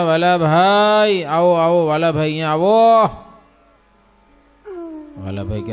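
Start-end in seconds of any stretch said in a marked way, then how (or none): 0.0-3.0 Maharaj calling out, raised voice; calling in deep voice. Retold or imitated. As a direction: call the devotee in from the room. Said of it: "ઘેરે સાદે બોલ્યા". (ઘેરા સાદે બોલાવવું)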